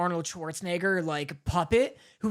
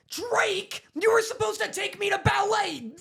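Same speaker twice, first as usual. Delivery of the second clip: high, whiny sitcom shout impression; Josh Peck; 11 semitones higher than usual. Scolding Drake about ballet, high shouted impression. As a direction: yell it up high like an aggrieved sitcom brother. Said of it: "I could do, like, a Josh Peck".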